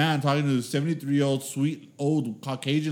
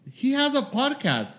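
high-pitched imitation of a sweet older lady; mom proudly announcing; 11 semitones higher than usual. Friend's mom telling her elderly friends, proudly announcing. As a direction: announce it to the ladies in a raised pitch.

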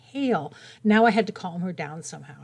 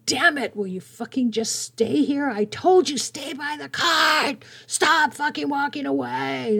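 high, raging rant at her father; angry yelling quote; 7 semitones higher, slower than usual. Retold or imitated. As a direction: snap at him like a furious daughter in public. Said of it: "She was yelling and screaming".

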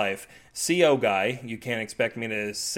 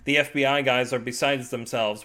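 usual speaking voice

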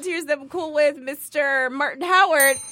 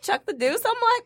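ordinary talk

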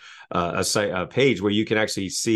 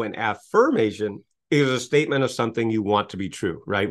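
ordinary delivery

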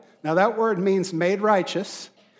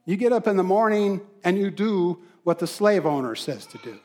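usual speaking voice

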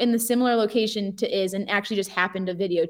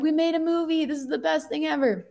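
higher-pitched, lightly acted joy; retold elation; 6 semitones higher than usual. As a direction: re-enact celebrating with your friend. Celebrating with friend, retold.

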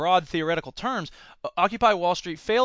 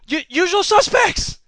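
gasp, then blurt it out much higher and louder; the sudden joy of catching the reference; excited recognition; 13 semitones higher, 8 dB louder, slower than usual. Retold or imitated. Student recognizing a movie reference, excited high blurt.

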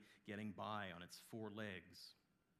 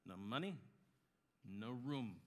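louder, dismissive innkeeper; curt refusal; 2 semitones higher, 5 dB louder than usual. Acted. Innkeeper refusing a penniless couple, curt refusal. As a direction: turn them away with force.

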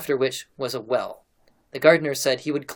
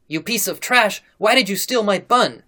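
outraged, high, loud scolding; angry accusation; 8 semitones higher, 7 dB louder than usual. A narrator's reading. Mistress catches serf boy stealing her bun, angry accusation. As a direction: raise pitch and volume in indignant accusation.

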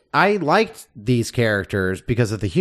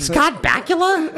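incredulous; slower than usual. Real, not acted. Incredulous at the name.

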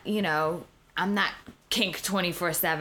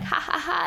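fake laugh. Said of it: "they'd laugh it off at first".

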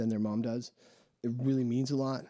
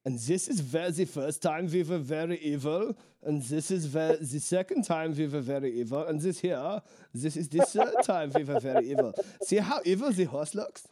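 lightly put-on Austrian guide accent, raised pitch; Austrian tour guide; 3 semitones higher than usual. Mocking Vienna statue tours to his friend, mild Austrian accent. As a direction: play a hint of an Austrian tour guide droning about evil history. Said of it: "That's really how they talk".

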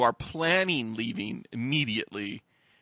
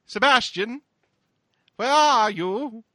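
high, drawn-out call into the darkness; mock yelling out; 9 semitones higher, 6 dB louder, slower than usual. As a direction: call out slowly and loudly, imitating someone lost in the dark. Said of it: "she's yelling out to the darkness".

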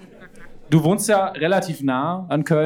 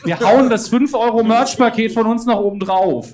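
excited; 6 semitones higher, 5 dB louder than usual. Real, not acted. Sweetening the auction deal.

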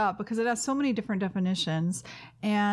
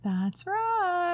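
high sing-song "That's right"; mock high chime-in; 10 semitones higher than usual. Cohost confirming the giveaway, playful high voice. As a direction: chirp it up high as a joke. Hosts echo each other.

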